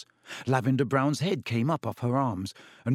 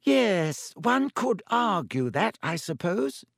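put-on much higher, slow wheezy old teacher; Professor Binns, slowly; 7 semitones higher, slower than usual. A narrator's reading. Amazed teacher conceding the student's point, slow and reluctant. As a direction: concede it slowly, drawing out the words. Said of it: "said Professor Binns slowly".

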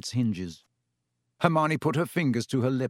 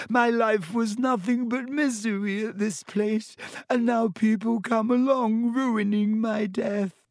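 high, wailing ghostly misery; howling; 10 semitones higher than usual. A narrator's reading. Myrtle laments her life and death, howling. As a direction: howl out the self-pity. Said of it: "howled Myrtle".

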